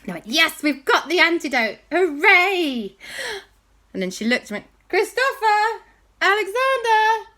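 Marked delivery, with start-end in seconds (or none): 0.2-3.0 put-on high, loud kid's cheer of triumph; bright loud hooray from the kids; excited cheer. Kids cheering the found antidote. 4.9-7.3 high, loud call across a distance; aunt shouting for her nephews. Acted. Aunt calling for missing boys.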